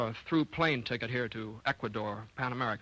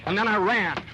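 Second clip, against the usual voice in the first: higher, louder rush; breathless excitement; 8 semitones higher, 8 dB louder than usual. A narrator's reading. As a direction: let it burst out as you break into a run. Narrator bursts into running.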